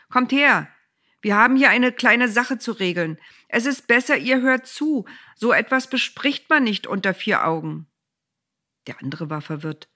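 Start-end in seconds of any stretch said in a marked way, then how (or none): 0.1-7.9 loud, higher call to the gathered men. A narrator's reading. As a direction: summon the townsmen to listen. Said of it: "rief Boas noch anderen zu". (laut und höher zu den Männern rufend)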